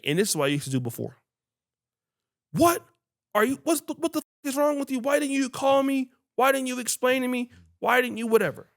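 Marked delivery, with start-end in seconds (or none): 2.5-8.7 high-pitched, exasperated demand for answers; retold outburst, pitched well up and incredulous; indignant complaint. Customer berating a late delivery man, high and indignant. Acting out his former angry complaint.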